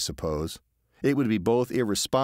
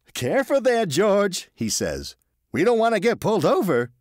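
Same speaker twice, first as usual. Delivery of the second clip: high, cautious passenger; anxious Marvin pleading caution; nervous Marvin voice; 11 semitones higher, 4 dB louder than usual. A narrator's reading. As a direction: voice the worried salesman, pitched up. Marvin's voice in the story.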